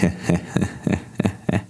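slow, evenly spaced evil chuckle; hollow villainous ha-ha-ha; sinister laugh. Acted. Villain laughing over the fallen brother, sinister. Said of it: "zaśmiał się złowieszczo".

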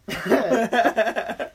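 slow, laughing tease. Real, not acted. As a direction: laugh it out slowly. Laughs while teasing.

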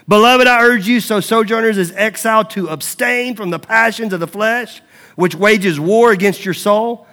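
emphatic preacher reading aloud; scripture reading. Acted. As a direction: proclaim the verse forcefully to the congregation. Reads scripture to congregation.

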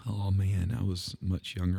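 ordinary talk

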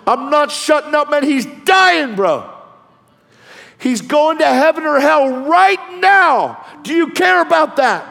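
high, shouted urgent argument; full-force preaching. Retold or imitated. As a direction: shout down a cop over a dying man. Shouts back at cop in sermon.

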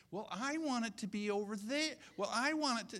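high-pitched mock demanding voice; self-centred insistence. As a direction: insist like someone who won't give way. Mimics someone insisting on their way.

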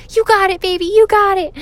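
put-on high, loud cheer to a child; cheering encouragement. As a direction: cheer her on from the audience. Cheering daughter from front row.

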